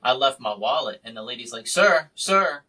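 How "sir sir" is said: raised, louder call for attention. Retold or imitated. Store lady calling after him for his forgotten wallet, calling out. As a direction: imitate someone calling after a customer.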